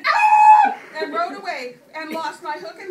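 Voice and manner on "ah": loud, high frightened scream. Retold or imitated. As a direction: let out a full terrified shriek. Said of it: "I screamed".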